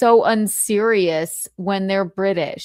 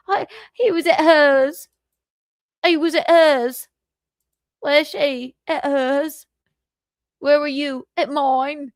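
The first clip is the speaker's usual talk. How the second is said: mock British accent; 6 semitones higher, 3 dB louder than usual. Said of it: "I really can't do a British accent".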